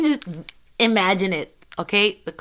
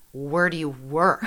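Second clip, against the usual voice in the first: low stern cop; 7 semitones lower, 5 dB quieter than usual. Retold or imitated. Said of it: "He says".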